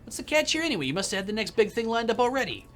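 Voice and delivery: suspicious New York producer; suspicious New Yorker. Acted. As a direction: probe him warily. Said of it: "eyes with deep suspicion".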